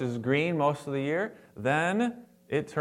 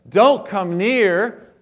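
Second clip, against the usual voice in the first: stern warning; 6 semitones higher, 8 dB louder, much slower than usual. Acted. God warning Moses from bush.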